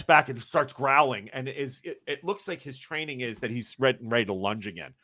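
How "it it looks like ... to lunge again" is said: ordinary talk, nothing marked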